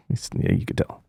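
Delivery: half-whispered remark; quick hushed comment; whispered aside. Real, not acted. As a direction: drop it to a whisper, almost to yourself. Muttered aside after quote.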